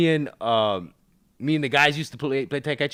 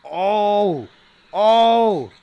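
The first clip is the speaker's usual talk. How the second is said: loud, high-pitched shouts of disbelief; real astonishment; 9 semitones higher, 9 dB louder, much slower than usual. Real, not acted. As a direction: holler in amazement as the reveal lands. Reacting live to trailer reveal.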